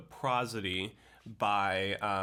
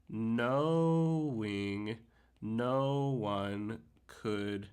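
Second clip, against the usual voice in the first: very slow, halting syllable-by-syllable reading; sounding out verse; much slower than usual. Shown as a demonstration. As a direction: stretch each stressed syllable, with long gaps between words. Sounds out poem's rhythm while marking.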